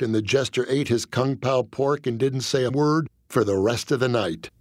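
ordinary delivery, nothing marked

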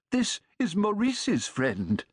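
put-on slow, high old man announcing. A narrator's reading. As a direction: present the guest proudly.

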